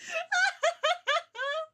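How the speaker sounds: very high, wheezy giggles; hysterical laugh. Real, not acted. Streamer losing it over whip image, laughing helplessly. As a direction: laugh till it turns into squeals.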